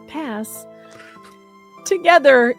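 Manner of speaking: usual speaking voice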